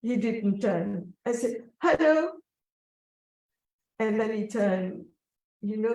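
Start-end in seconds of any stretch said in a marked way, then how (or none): 1.8-2.3 high, louder hello with a rising end; lifted, questioning hello; calling out again. Retold or imitated. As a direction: call him once more, insisting. Calling out a second time.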